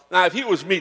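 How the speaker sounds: ordinary delivery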